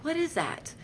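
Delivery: very high, startled question; shocked at the needle going in; alarmed question. Retold or imitated. IV stuck in her.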